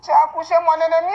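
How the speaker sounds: usual speaking voice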